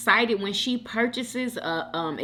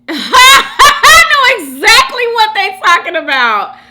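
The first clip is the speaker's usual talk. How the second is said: high, loud words through laughter; shrieking with amusement; laughing hard; 14 semitones higher, 14 dB louder than usual. Real, not acted. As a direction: crack up in recognition while talking. Cracks up at influencer accent comment.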